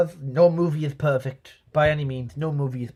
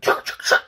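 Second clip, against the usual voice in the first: imitated gunshots. Imitating gunfire in car chase.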